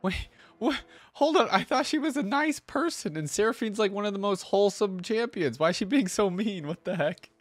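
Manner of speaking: high-pitched, taken-aback protest; real surprise. Real, not acted. Reactor thrown by a champion's unexpected meanness, startled and high. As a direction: blurt it out in high, startled disbelief.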